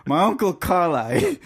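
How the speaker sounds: Trinidadian "Carlisle", said Car-lie; Trinidadian way of saying "Carlisle"; Trinidadian pronunciation. Shown as a demonstration. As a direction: drop the final L of "Carlisle", Trinidadian style. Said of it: "But in Trinidad, it's like".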